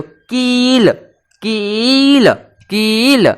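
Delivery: कील with a long held ई, stressed; stretched कील. Shown as a demonstration. Teacher stretching कील to show the ई sound. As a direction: lean on की and hold the ई. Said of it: "जब आप की पे जोर दो".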